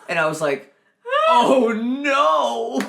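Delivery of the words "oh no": high, loud cry of horror; shocked cry. Retold or imitated. Waiter realizes the guests are British, a loud mock-horrified cry. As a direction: shriek it in mock panic.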